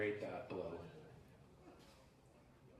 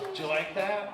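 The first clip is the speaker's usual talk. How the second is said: louder, teasing call to the crowd; loud teasing question; 5 semitones higher, 6 dB louder than usual. Real, not acted. Host teasing the crowd after his yelp, loud. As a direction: tease them with it.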